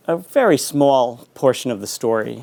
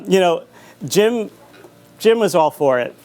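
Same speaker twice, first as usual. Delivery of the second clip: ordinary talk, nothing marked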